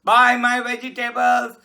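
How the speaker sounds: street vendor shout. Acted. Vegetable vendor calling out to buyers in the street. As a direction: call it out like a cart vendor.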